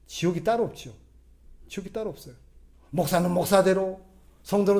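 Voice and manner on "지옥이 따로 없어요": softer repeat. Shown as a demonstration. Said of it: "repeated softer".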